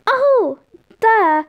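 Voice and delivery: high sing-out oh, then duh; amused realisation. A narrator's reading. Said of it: "Tom laughed to himself".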